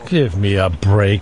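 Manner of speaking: low, slow comic grumble; grumbling voice. Pretend. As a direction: drag it out, exasperated. Groaning at Volvo jargon.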